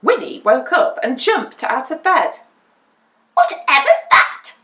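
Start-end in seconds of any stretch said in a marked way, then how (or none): 3.3-4.6 high, loud startled witch voice; jolted awake, what on earth. A narrator's reading. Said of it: "she said".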